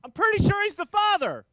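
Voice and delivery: very high, loud squealing wife; shrill mock getaway driver; mock wife shout. Wife calling out from the car, high, loud mock voice. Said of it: "gets out and says".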